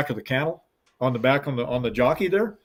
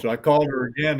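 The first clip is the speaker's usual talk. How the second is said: usual speaking voice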